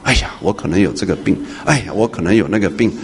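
soft, low imitation of a worrier fussing over imagined illness; a quiet fretting sigh before each worry; fretful worrier. Preacher mimicking a churchgoer fretting over imagined illness, in a soft fretful voice. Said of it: "你不要在那边胡思乱想".

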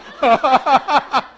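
loud, delighted laughing burst; a happy peal of laughter; joyful laughter. Acted. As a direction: laugh out with pure joy. Thief laughs with joy.

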